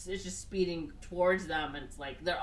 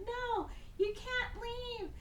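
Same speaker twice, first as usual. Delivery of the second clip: much higher and slow, a lightly voiced pleading woman; mock pleading girlfriend; 5 dB quieter, much slower than usual. Elizabeth begging Dalton to stay, high slow mock plea. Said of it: "And she's like".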